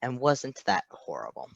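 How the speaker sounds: ordinary delivery, nothing marked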